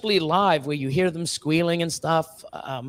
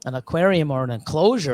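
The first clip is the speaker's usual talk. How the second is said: ordinary talk, nothing marked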